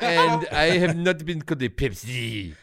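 slow, hammed-up French Canadian accent; mock French Canadian accent. Mocking French Canadians who doubt the slur, in French Canadian accent. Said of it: "Like that".

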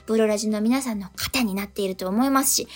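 ordinary talk

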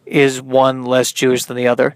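normal talking voice

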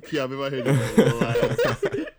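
loud, high laughing outburst; laughing disbelief, voice rising high. Real, not acted. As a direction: crack up while calling it abusive. Laughing at the story.

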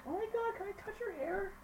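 very high put-on squeal; gushing curious local. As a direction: imitate someone naively fascinated. Imitating gushing curious local people.

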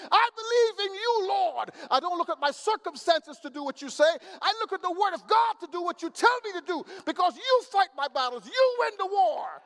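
loud rising sermon cry; preaching at full force. Real, not acted. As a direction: build to a shouted declaration.

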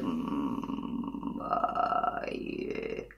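very low, creaky fry; vocal fry. Shown as a demonstration. Singing teacher demonstrating vocal fry to students. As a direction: crackle at the bottom of the voice. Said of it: "controléis bien el vocal fry".

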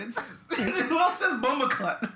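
slow, laughing through the words; can barely get it out for laughing; laughing question. Real, not acted. Friend cracking up over the slang, laughing. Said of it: "laughing at the word".

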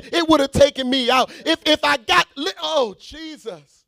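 loud, high preacher's cry; shouted praise to the church; preaching at full force. Real, not acted. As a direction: rouse the congregation.

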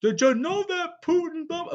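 high, loud parody of uninformed chatter; mocking know-it-alls. Host imitating people talking about war, mocking. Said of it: "'Cause they go".

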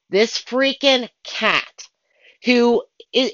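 normal talking voice

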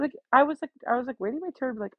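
ordinary delivery

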